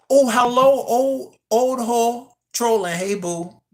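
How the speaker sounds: high, loud, sing-song fake-sweet hello; mock sweet greeting. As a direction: greet the troll in a sugary put-on voice. Mock greeting to a chat troll.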